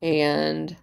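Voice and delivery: ordinary talk, nothing marked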